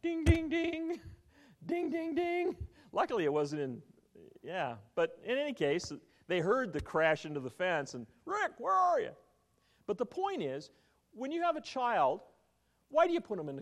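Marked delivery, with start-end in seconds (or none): 0.0-2.5 high sing-song ding ding ding; mock bell ding. Preacher mimics bell after hitting fence, high sing-song chime. 8.2-9.2 calling out. Retold or imitated. Grandfather calling for lost boy.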